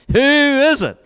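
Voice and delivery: high, loud dramatic question; sailors' loud demand; demanding accusation. Acted.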